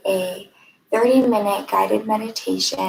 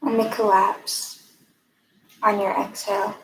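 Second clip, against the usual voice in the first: calm, even guiding voice with long pauses; guided meditation. Acted. Mindfulness fellow leading a breathing exercise, guided meditation. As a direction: lead listeners gently inward, leaving room between phrases.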